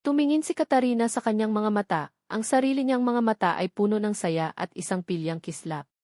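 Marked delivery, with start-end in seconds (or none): none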